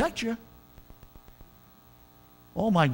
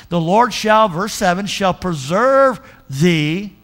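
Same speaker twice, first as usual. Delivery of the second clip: louder scripture proclamation; 4 dB louder, slower than usual. Acted. Pastor proclaiming the verse loud to the church. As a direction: ring out the Lord, stretch out preserve.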